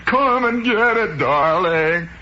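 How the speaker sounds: high, loud singsong call; hammed-up dandy calling his darling to dinner; doting cook. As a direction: call it out sweetly, stretching the words. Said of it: "he turns and says".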